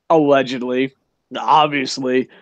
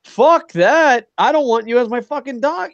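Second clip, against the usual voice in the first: mock outrage; 6 semitones higher than usual. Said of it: "he's like".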